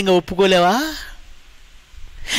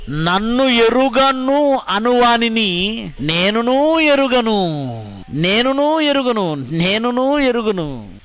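slow, higher, weighty recitation; solemn scripture reading; 5 semitones higher, much slower than usual. Acted. Preacher reciting the verse to the congregation. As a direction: intone each word like a verdict.

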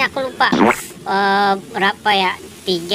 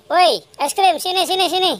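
calling out; 6 semitones higher, faster than usual. Acted. Calling vendor back.

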